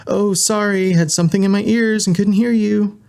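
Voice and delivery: high, singsong fake innocence; sweetly insincere excuse in a high voice; mock apology. A narrator's reading. Candy pretends she could not hear.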